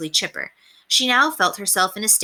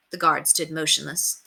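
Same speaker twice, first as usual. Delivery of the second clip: ordinary delivery, nothing marked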